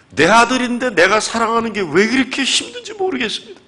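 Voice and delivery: high, loud crying plea; tearful confession. Retold or imitated. Father confessing to the pastor through tears. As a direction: lament through tears, voice straining.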